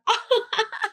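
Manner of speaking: high, exaggerated head-back laugh; hammed-up high cackle; imitated mocking laugh. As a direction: mimic someone laughing in your face. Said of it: "The other plus one laughs".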